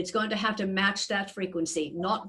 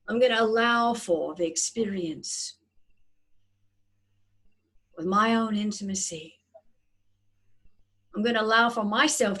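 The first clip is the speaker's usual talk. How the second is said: guided meditation. Acted. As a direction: gently guide their breathing. Leads a breathing meditation.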